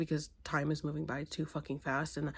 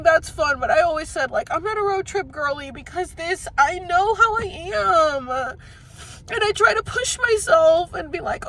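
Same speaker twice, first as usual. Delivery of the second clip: high, loud, upset wail; real tearful venting; 13 semitones higher, 10 dB louder than usual. Real, not acted. Venting alone on a road trip, tearful and loud. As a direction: talk loudly through tears.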